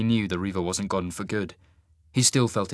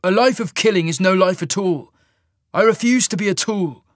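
loud, higher defiance; defiant; 8 semitones higher, 7 dB louder than usual. Acted. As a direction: shout your refusal. Cole defies Marius.

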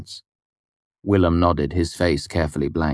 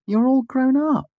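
very high, delighted female voice; fond admiration; 3 dB louder than usual. A narrator's reading. Devi hugs Fela, high and fond. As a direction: coo over her grown-up friend. Devi greets old friend.

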